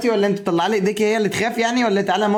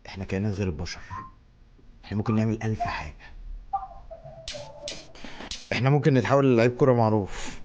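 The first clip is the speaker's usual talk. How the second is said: demonic voice; 10 semitones lower, 7 dB quieter than usual. Acted. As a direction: speak as a sinister otherworldly being. Spooky demonic voice-over skit. (صوت كائن شيطاني عميق ومخيف)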